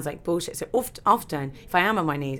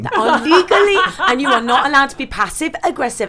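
louder, higher words through laughter; laughing; 8 semitones higher, 10 dB louder than usual. Real, not acted. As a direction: laugh your way through the joke. Laughing while riffing on joke.

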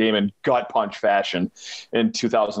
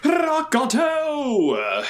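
hype shout; much slower than usual. Acted. Cohost shouts sponsor name.

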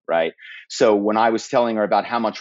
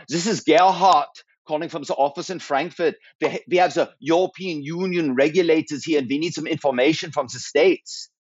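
put-on higher-pitched German-accented caller; 5 semitones higher than usual. Impostor calling the States from the Frankfurt office, German accent. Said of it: "So I would be doing some accent".

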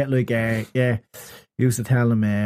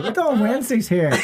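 teasing lie; 8 semitones higher than usual. Retold or imitated. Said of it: "what we used to say to them".

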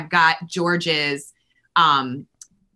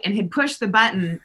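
usual speaking voice

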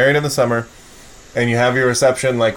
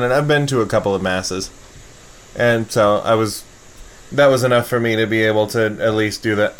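normal talking voice

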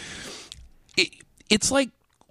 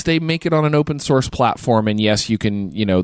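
ordinary talk, nothing marked

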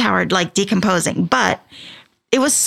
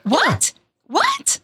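rising to a high squeal of disbelief; can't believe what you just heard; real astonishment; shocked; 13 semitones higher than usual. Real, not acted. Hearing of his drug trips.

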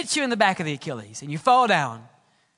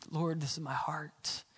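much softer and lower, inward; a quiet confession to God, softer and lower; hushed prayer; 6 semitones lower, 13 dB quieter than usual. Retold or imitated. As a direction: speak it softly to the wall. Hezekiah turns his face to the wall.

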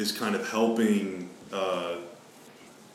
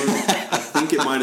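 laughing; 5 semitones higher, 5 dB louder, slower than usual. Real, not acted. Laughing about unclear job title.

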